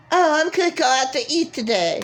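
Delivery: lightly voiced high mockery of him; mock indecisive voice. Mimicking her indecisive ex announcing his plans, light mock voice.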